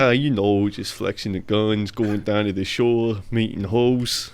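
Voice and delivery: slow, swaggering Jersey tough guy; wiseguy drawl from New Jersey; Jersey accent. Pretend. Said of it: "we do the Jersey accent".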